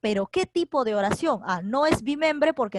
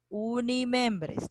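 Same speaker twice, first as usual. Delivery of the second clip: slow emphasis; 3 dB quieter, much slower than usual. Real, not acted. Teacher stresses key term.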